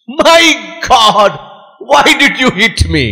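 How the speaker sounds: high-pitched acted protest; indignant outcry. Man turned to prince after being hit, shocked high protest. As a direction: cry out high, then come down complaining.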